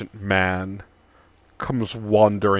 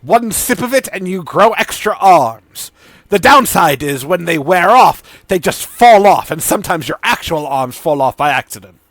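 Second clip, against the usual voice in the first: much higher and louder eager pitch; mad scientist Sidon; 4 semitones higher, 9 dB louder than usual. Acted. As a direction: sell the potion like a gleeful mad scientist.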